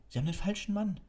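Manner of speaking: shouting. A narrator's reading. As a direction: cry it out at the minister. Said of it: "schrie Harry". (laut protestierend, hohe Stimme)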